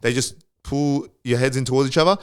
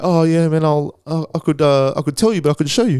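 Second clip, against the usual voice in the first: lightly put-on Kiwi accent, higher pitch; 5 semitones higher than usual. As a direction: imitate a helpful Kiwi guy, pitched up. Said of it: "that's my fucking Kiwi accent".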